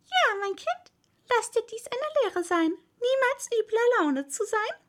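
put-on high, preachy old toad voice; old toad lecturing. A narrator's reading. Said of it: "eine alte Unke ... zu ihrer Tochter".

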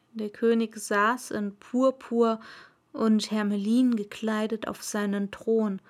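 plain reading voice

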